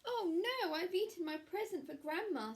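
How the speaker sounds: put-on a little girl caught out, oh no; dismayed Red. A narrator's reading. Red realises she ate the present.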